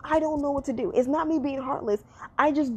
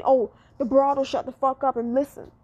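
usual speaking voice

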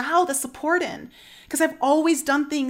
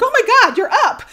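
laughing greeting; 10 semitones higher, 9 dB louder, faster than usual. Retold or imitated. Luis greets her, laughing.

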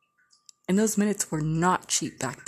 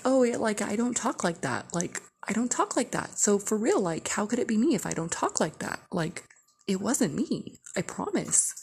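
put-on innocent protest, pitched up in swoops; innocent denial voice; 4 semitones higher than usual. Girl rehearsing her lie to escape trouble, mock innocent. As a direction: play innocent with a higher, swooping voice. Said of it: "in my normal speaking voice".

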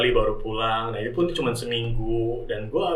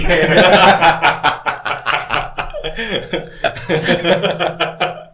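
loose, rolling laughter; long laugh; 4 semitones higher, 4 dB louder than usual. Real, not acted. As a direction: let a long laugh run. Long laugh after the joke.

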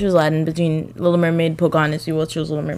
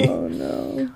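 much lower mock dismay; deep mock groan; 9 dB quieter than usual. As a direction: drop way down in fake despair. Mock dismay at friend's choice.

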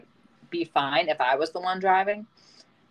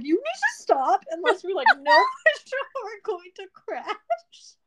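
panicky high voice; 14 semitones higher than usual. Retold or imitated. Retold panic at husband braking.

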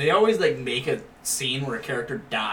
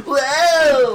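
high loud whoa of fright; mock startled yelp; 11 semitones higher, 8 dB louder than usual. [name] spots the vampire lady behind him, startled whoa. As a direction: yelp like a startled horror movie victim.

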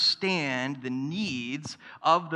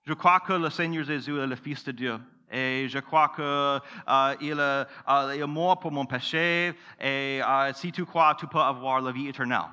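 French said in a West African accent, halting. Shown as a demonstration. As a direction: en français avec un accent ouest-africain. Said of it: "my West African accent".

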